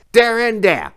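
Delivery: high, loud shout; yell out. Acted. The hobo yells the signal for the shooters, loud and high. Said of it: "yell out".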